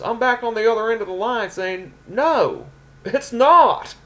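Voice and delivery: high-pitched Memphis wrestler, exasperated; Honky Tonk Man. Acted. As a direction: build to an exasperated denial. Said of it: "Honky Tonk Man's from Memphis".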